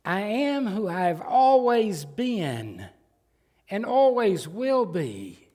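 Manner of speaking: solemn proclamation. Acted. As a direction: proclaim it slowly like God's own name. Preacher proclaims God's self-name.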